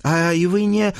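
protesting page. Acted. Page tries to explain himself, protesting. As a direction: start pleading in a high pitch. Page argues, cut off by lord.

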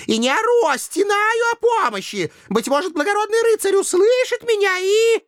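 very high, thin damsel voice; pleading nereid. A narrator's reading. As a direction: cry out for a noble rescuer.

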